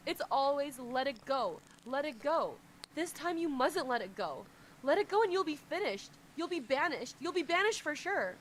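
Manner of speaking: loud, high anguished plea; crying out. Acted. Bound subordinate crying out to his master, loud and high. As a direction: scream at him not to let it go. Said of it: "he cries out".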